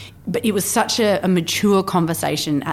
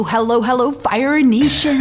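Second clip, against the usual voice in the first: bad American accent; 8 semitones higher, 5 dB louder than usual. Podcaster mimicking John Lee Dumas in a bad American accent. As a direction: imitate a bad American accent, rising and punchy. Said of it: "that's the worst American accent".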